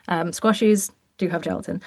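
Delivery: ordinary talk, nothing marked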